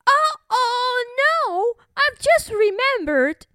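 high, faltering excuse; frightened bully bird. Acted.

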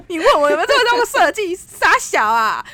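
high, indignant mocking of the question. Ranting to her co-host about a rude coworker, mock indignant. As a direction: scoff at the insult.

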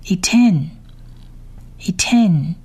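"Iten" alone as i-TEN, then a pause; word-by-word reading. Shown as a demonstration. Teacher reads the words one by one, careful word drill. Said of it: "我们逐一来念一次他的单字".

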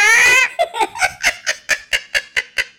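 big loud laugh; loud laughter. Real, not acted. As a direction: burst out laughing. Laughing at punchline. (大笑出声)

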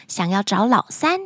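plain reading voice

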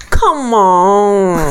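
slow, drawn-out breathy "come on". Shown as a demonstration. Host shows co-host the slow breathy come-on. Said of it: "或者是come on".

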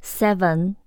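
ordinary talk, nothing marked

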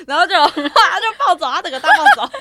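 laughing loudly while telling it; giggling high and loud about mom's rampage. Real, not acted. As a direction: burst out laughing mid-sentence.

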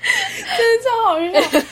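high and slow through real laughter; can barely get the words out for laughing. Real, not acted. Laughing while telling a friend the school story.

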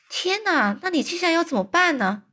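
high, fast worried question; surprised. Acted. Sophie asks the giant what he will do, alarmed. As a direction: ask quickly in alarm.

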